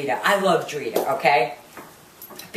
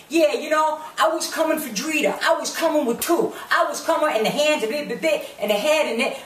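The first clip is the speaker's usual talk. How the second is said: high-pitched mocking mimicry; Karen; 6 semitones higher than usual. Retelling Karen's threat about Drita, mocking garbled higher voice. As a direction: ramble it in a higher voice, dissolving into nonsense syllables. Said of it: "and she's going".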